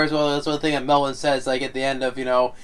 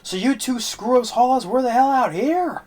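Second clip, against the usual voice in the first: mocking angry quote; 11 semitones higher, 4 dB louder than usual. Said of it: "said like".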